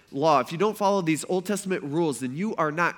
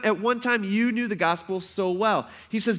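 usual speaking voice

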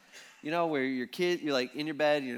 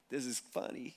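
much softer, low amused aside; 4 semitones lower, 12 dB quieter than usual. Retold or imitated. Jesus with one eye open, softly amused. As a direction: keep it to yourself, quietly amused.

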